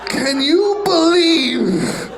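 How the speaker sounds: high, slow disbelief; incredulous. Acted. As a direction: stretch it out in disbelief. Incredulous comic aside.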